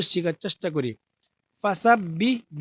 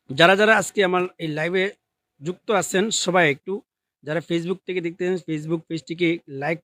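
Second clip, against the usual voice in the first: ordinary delivery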